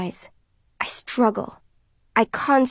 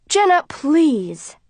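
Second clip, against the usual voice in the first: slow, higher plea; mother begging daughter to sit; pleading; 7 semitones higher, 3 dB louder, much slower than usual. Acted. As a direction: beg her gently. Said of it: "Mother pleads".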